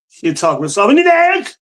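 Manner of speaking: a sneering imitation of Ilhan; mocking her talk. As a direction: mimic her odd way of talking. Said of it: "Why does she talk like that?".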